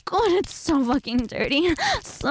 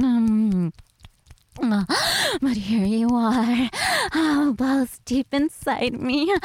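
normal talking voice

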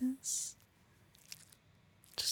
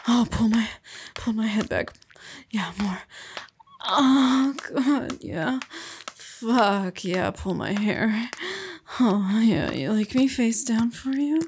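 louder breathless pleading; loud moaning; 5 dB louder than usual. Acted. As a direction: moan out each demand. Pleading during sex.

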